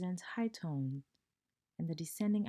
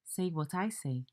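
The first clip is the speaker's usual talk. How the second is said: ordinary delivery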